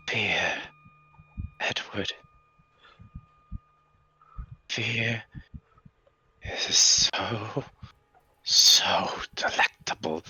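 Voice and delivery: hushed, savoring whisper; creepy creature whisper. Acted. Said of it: "this thing looks at you and goes".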